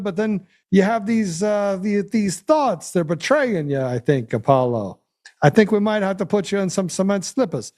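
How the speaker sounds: put-on mobster, menace dressed as friendly talk; mobster accent. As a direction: threaten him like a movie gangster. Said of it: "Mob shows back up and says".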